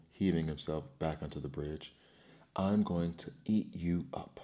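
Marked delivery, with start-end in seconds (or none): none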